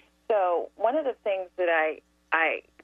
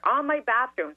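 ordinary delivery

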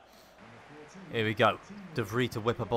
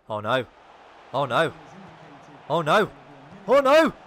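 rising dread; 4 semitones higher, 10 dB louder than usual. Real, not acted. Gamer watching the rival attack late, dread building to a wail. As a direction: dread climbing with each oh no.

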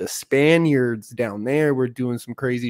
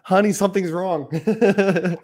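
worried partner; 6 semitones higher than usual. Retold or imitated. Mimicking worried partner at bedside.

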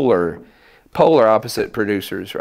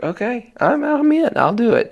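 eager yes; 14 semitones higher than usual. Retold or imitated. Retold eager acceptance of gig.

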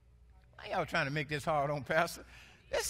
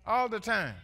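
loud, driving preacher's call; full-force preaching; 2 semitones higher, 11 dB louder than usual. Acted. Pastor preaching loud to his church. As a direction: lift the room with it.

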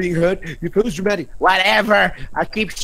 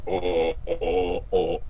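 very low guttural oh sounds; deep, guttural repeated vocal noise; 11 semitones lower, 5 dB quieter, much slower than usual. Shown as a demonstration. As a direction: make low throaty ohs. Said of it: "very guttural, like".

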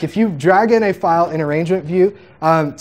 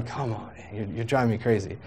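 muttered under the breath, much softer, fed up; exasperated mutter; 5 semitones lower, 10 dB quieter than usual. Retold or imitated. To the drummer who hated the click, a soft exasperated mutter. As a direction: grumble it half to yourself. His exasperated reply, retold.

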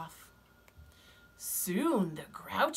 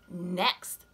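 ordinary delivery

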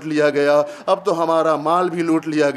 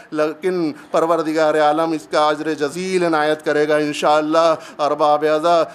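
ordinary talk, nothing marked

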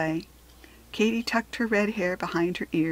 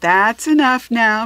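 firm teacher; 3 semitones higher, 9 dB louder, slower than usual. A narrator's reading. Teacher calms the class.

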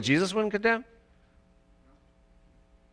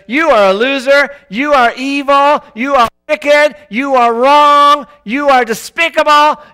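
the devil accusing; 9 semitones higher, 11 dB louder than usual. Retold or imitated. Devil condemns the listener, loud accusing rant. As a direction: hammer each insult home. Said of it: "And the devil says".

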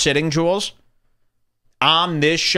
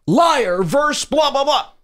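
high shouted emcee hype; imitating a shouting roast moderator; Brian Moses; 13 semitones higher than usual. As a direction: yell it like a battle host firing up a crowd. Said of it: "Brian Moses goes".

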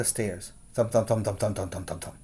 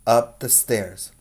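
ordinary delivery, nothing marked

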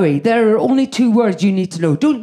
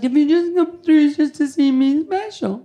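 higher, mock-sweet daughter asking; daughter's voice; 7 semitones higher than usual. Daughter asks her mother if she came just to see her, lightly higher voice. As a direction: voice the twenty-year-old a bit higher.

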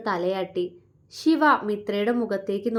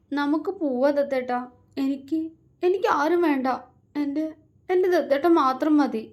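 higher, halting words through sobs; cling to him and sob it out; girl sobbing into her beloved's chest; 5 semitones higher than usual. A narrator's reading. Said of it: "തേങ്ങിക്കൊണ്ട് അവനോട് പറഞ്ഞു".

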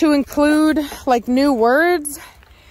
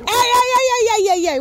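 sharp, much higher shouted hey hey to stop a fight; shouting at the dogs; 11 semitones higher than usual. Real, not acted. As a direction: bark it out to break up the dogs. Breaking up a dog fight.

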